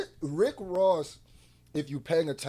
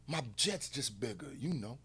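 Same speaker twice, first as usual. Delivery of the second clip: hushed mock-petty rapper; a soft, lowered imitation of a petty boast; 4 semitones lower, 10 dB quieter than usual. Host mimics the rapper's petty dig, soft imitation. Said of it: "then he'll do some petty".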